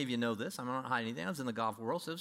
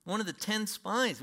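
normal talking voice

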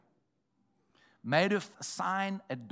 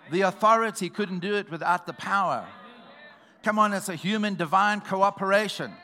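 loud, higher preaching climax; full-force preaching; 4 semitones higher, 7 dB louder than usual. Acted. Preacher rousing the church to their authority, shouting. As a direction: preach it out to the congregation.

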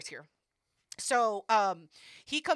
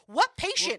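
sharp high incredulous question; snapping back in disbelief; 8 semitones higher, 8 dB louder than usual. Retold or imitated. To the receptionist, incredulous and sharp.

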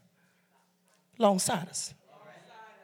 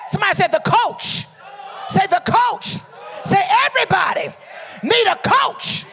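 loud, high phrases left for response; preacher's call-out; 7 semitones higher, 6 dB louder than usual. Real, not acted. Preacher has congregation repeat the coach, shouting each phrase.

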